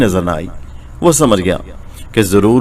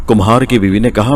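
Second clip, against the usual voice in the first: ordinary delivery, nothing marked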